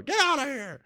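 a hint of a girl shooing an animal; mock yell at coyote. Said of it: "I heard Mariah yelling out the door".

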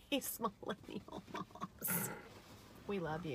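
soft breathy teasing aside; whispered aside. Real, not acted. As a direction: drop to a whisper and tease. Teasing aside about moms.

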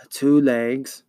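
eerie swarm-of-hornets creature voice; buzzing monster voice. Acted. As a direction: let the monster's buzz shape the words slowly. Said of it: "very high and slightly hoarse".

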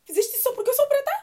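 high, fast mock accusation; mock accusing friend. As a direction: toss it off as a joking accusation. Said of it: "brincado com essa cena de dizer".

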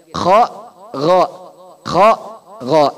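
rasped kho and gho from the upper throat. Shown as a demonstration. Teacher drilling kho and gho. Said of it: "Kho sama gho".

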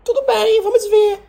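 very high, whiny cat meow. Acted. Cat answering the tiger from the tree, high meowing voice. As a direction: mew the words like a cheeky little cat. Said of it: "O gato olhou pro tigre e falou assim".

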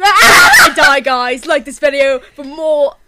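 lightly voiced YouTuber sign-off, loud start; Bentham. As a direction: do a mock YouTuber outro. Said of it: "your voice for Bentham".

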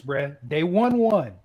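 usual speaking voice

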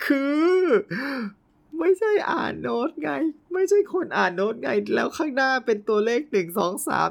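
high, astonished exclamation. Real, not acted. Realising the choir reads numbers, high astonished voice. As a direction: voice leaps up in disbelief. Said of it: "shocked at number notation".

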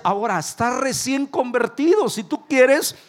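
high, loud, driving sermon; full-force preaching. Acted. Pastor urging the church to serve, preaching loud and high. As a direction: preach it to the back row.

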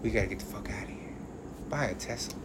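very low, quiet mimicry; imitating furtive warning. Playing the musky billionaire on TV, low muttered imitation. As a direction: mutter it low like a secret tip. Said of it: "Gonna be that musky little motherfucker".

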